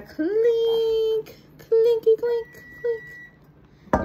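slow, high, drawn-out clink; singsong toast; playful singsong. Pretend. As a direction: stretch it out playfully.

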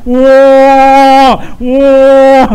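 long, loud held howl; mock wailing. Teasing a singer fit for New Year noise, loud mock wail. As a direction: blare one long sustained note.

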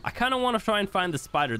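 ordinary delivery

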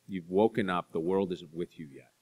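normal talking voice

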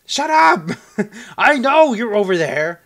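high angry shout. Real, not acted. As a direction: yell it at the screen. Yelling at game enemies.

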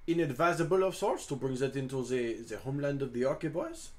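put-on French-accented adventurer, courteous and expansive; [name] in character. [name] asks a friend about the blue dragon, in character. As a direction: play a courtly Frenchman addressing a companion.

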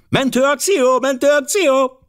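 put-on very high, girlish rallying cry; shouting. A narrator's reading. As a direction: shout it like excited girls running off. Girls shout while rushing out. (magas, lelkes lányos kiáltás)

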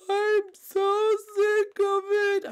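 slow, stretched-out fed-up whine; drawn-out exasperation. Acted. Peach fed up with Mario, slow exasperated whine. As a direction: drag every word out in frustration.

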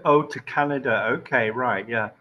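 usual speaking voice

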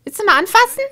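high, loud, fast panicked wife; wife in labour. Acted. Pregnant wife yelling at the husband about her contractions.

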